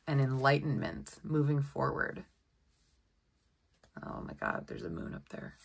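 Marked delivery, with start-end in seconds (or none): 4.1-5.5 hushed, breathless whisper; awed whisper. Real, not acted. Noticing a tiny moon on the card, hushed whisper. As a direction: whisper as if spotting a secret.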